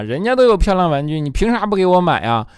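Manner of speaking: put-on a kid nagging mom for toys; whiny kid.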